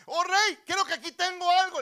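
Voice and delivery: very high, shouted acted call; urgent calling out. Beggar calling back the departing king, shouting urgently. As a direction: yell after the king to stop him.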